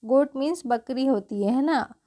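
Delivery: ordinary talk, nothing marked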